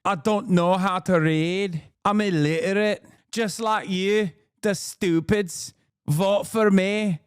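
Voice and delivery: slow, drawn-out dimwit voice; mock dummy. Candidate appealing to fellow stupids, slow mock-dumb voice. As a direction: play a proud dummy, dragging out the words. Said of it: "She should have tried being like".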